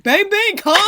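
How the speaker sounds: loud high gleeful cheer; mock hyped outburst; excited shout. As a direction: cheer like nothing's wrong. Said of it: "I'm over here like".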